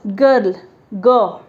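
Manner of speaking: "गर्ल" slowly, then "ग" alone as a hard g; pronunciation demo. Shown as a demonstration. Teacher showing girl's first sound, slowly demonstrating "गर्ल" and "ग". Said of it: "जब हम इसको उच्चारण करें".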